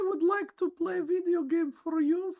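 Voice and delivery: high-pitched Russian girl put-on; Russian gamer girl. Improvising on friend's request, high mock Russian gamer-girl voice. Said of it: "like, a Russian gamer girl something".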